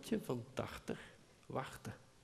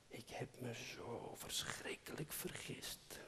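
a voiceless whisper, inward; whispered thought; 15 dB quieter than usual. A narrator's reading. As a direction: whisper it to yourself in regret. Innerlijke gedachte na "dacht. (gefluisterde gedachte)

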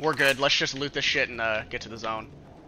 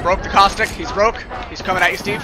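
excited callout; 7 semitones higher, 6 dB louder than usual. Real, not acted. Callouts mid firefight.

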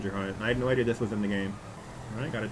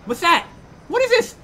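much higher, louder burst of excitement; excited; 9 dB louder than usual. Real, not acted. Thrilled by a find in the game, excited. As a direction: blurt it out, thrilled.

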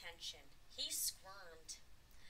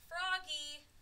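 loud, high greeting call; 7 semitones higher, 7 dB louder than usual. A narrator's reading. Father greets Froggy off the school bus, calling out. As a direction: hail the child by name.

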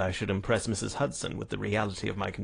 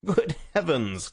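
louder, higher exclamation, drawn slow; startled cry; 6 semitones higher, 5 dB louder, slower than usual. A narrator's reading. Detective remembering his hidden friend, startled raised cry. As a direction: cry out in sudden realisation. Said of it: "cried Holmes".

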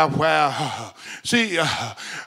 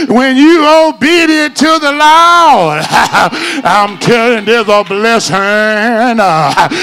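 preaching at full force; 5 dB louder, slower than usual. Acted. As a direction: climactic hooping preacher, loud and sung-out.

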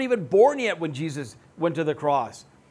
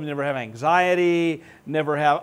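usual speaking voice

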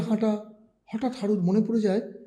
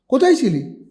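higher, louder demand; insistent mother; 6 semitones higher, 6 dB louder than usual. A narrator's reading. Mother demanding where her son was, louder. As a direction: press him for an answer.

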